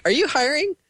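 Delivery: high-pitched question. Retold or imitated. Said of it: "he's like".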